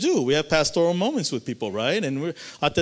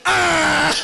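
mock wail; 13 semitones higher, 7 dB louder than usual. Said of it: "all of us just started crying".

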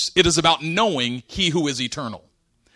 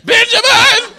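loud, very high comic wailing; mock crying; 12 semitones higher, 8 dB louder than usual. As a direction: imitate a great-uncle bawling his farewell. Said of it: "He come up crying again".

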